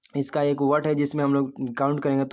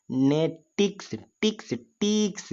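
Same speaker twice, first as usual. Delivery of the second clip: Ticks slow, higher, with a stretched i; stress on ticks; 5 semitones higher, much slower than usual. Shown as a demonstration. Teacher showing the third syllable, ticks stretched and raised. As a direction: split नेटिक्स and lean high on ticks. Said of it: "हम लोग stress develop कर रहे हैं".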